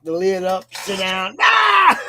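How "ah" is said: high, loud scream of fright; mock scream. Acting out sitting down on a snake, mock scream. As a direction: shriek as if bitten, hammed-up.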